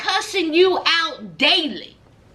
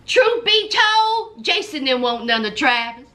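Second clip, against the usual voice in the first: normal talking voice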